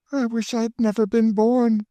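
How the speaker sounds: put-on high, despairing girlish cry. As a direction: wring your hands in despair. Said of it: "wringing her hands".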